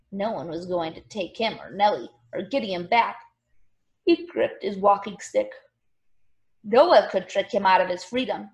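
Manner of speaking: ordinary delivery, nothing marked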